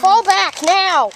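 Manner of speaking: slow, loud order to pull back; frantic retreat order. Pretend. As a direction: yell the retreat. Pretend battle, shouted retreat order.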